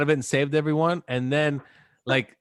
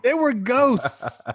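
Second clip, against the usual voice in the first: higher, louder, slow reveal; mock spooky; 9 semitones higher, 4 dB louder, much slower than usual. Real, not acted. Telling friends the ballplayers were ghosts, mock spooky. Comic emphasis.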